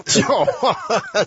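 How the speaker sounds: high, laughing mock yell; laughing shout. Teacher echoes the student's wrong guess, laughing shout. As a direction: burst out laughing as you echo it.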